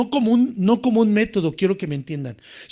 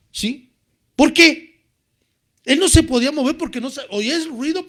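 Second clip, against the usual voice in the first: normal talking voice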